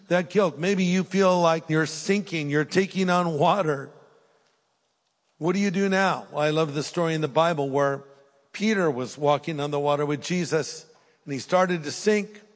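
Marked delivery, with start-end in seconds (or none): none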